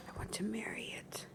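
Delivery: soft breathy whisper of adoration. Real, not acted. Whispering to the camera about her yogurt, lovestruck. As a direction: whisper it like a secret love confession.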